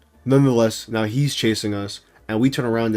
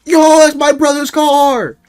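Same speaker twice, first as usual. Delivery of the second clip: very high, loud squealed shout; silly friend voice; 9 dB louder than usual. Retold or imitated. Imitating friend spotting his brother's car, silly high yell. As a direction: squeal it like an over-excited cartoon friend. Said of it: "I don't know why I'm doing that voice".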